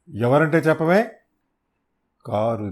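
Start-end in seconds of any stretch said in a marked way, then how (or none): 0.1-1.1 a rough man gets pushy; louder demand. Acted. Thug snapping at a stranger, a louder demand. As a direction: raise the voice and demand a name.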